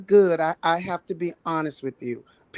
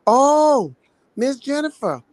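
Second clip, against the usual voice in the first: higher, louder put-on voice of a patient, briefly; retold disguised voice; 9 semitones higher, 5 dB louder than usual. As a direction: imitate a patient disguising his voice on the phone. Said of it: "He tried to disguise his voice".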